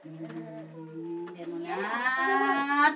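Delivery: very slow, drawn-out hesitation; hesitant musing. Retold or imitated. Teacher recalls doubting the move, slow hesitant sigh. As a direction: stretch it out, unsure and torn. Torn about moving to Fukui.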